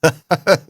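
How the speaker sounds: short, high malicious chuckle; nasty little cackle; malignant laugh. A narrator's reading. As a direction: laugh with spite, loud and brief. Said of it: "he laughed malignantly".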